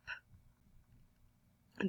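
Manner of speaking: P as a soft breathy puff with no voice; just push air out for P; voiceless p puff; unvoiced P. Shown as a demonstration. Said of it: "That is the unvoiced sound".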